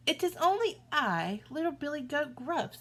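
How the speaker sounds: high little goat voice; timid little goat. A narrator's reading. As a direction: answer small and sweet. Little goat answers troll.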